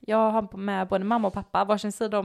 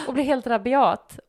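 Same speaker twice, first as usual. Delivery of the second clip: normal talking voice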